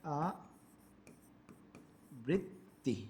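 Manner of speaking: "আবৃত্তি" very slow and soft, in separate syllables; soft, drawn-out "আবৃত্তি" with long gaps between syllables; spelling out slowly. Shown as a demonstration. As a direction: say "আবৃত্তি" piece by piece as if writing it. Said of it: "আমি যদি একটু লিখি".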